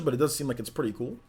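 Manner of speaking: ordinary talk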